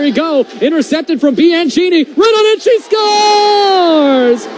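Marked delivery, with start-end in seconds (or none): 0.6-2.6 rising, higher shouted play call; excited shouting. Real, not acted. Build-up to a goal call. 2.6-4.5 long held high goal shout; long goal yell. Real, not acted. Goal scored in play-by-play.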